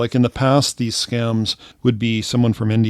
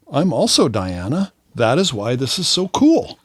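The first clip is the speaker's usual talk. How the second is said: higher, swooping gushy voice; mock delight; 4 semitones higher than usual. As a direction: gush like a thrilled fake friend. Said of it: "I go".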